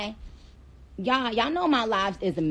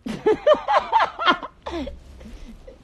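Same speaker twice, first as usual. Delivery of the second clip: high cackling laugh; 10 semitones higher, 4 dB louder than usual. Real, not acted. Streamer laughing at her own demand, high cackle. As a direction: burst of high, real laughter.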